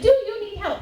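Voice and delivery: high, chirpy imitation of the Word paperclip; mock eager helper voice. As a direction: pipe up like a helpful cartoon assistant. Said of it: "And he's like".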